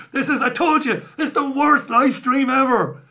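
high, loud, laughing outburst; laughing exclamation. Real, not acted. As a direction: exclaim it helplessly through laughter. Phone rings mid-stream, he bursts out laughing.

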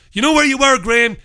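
loud, high jab at Graham; angry call-out. Real, not acted. Ranting host calls out Graham Linehan, loud and angry. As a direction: demand an answer, raising the voice.

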